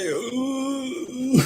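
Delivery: very high, hurt little ooh; Usopp's pained ooh. Retold or imitated. Retelling, Usopp smacked by the giant beast, squeaking very high. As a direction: squeak it out like he's just been smacked. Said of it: "this man's like".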